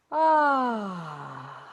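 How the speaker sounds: long falling sigh on ah; audible sigh. Shown as a demonstration. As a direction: demo sigh for the group. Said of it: "exhale with".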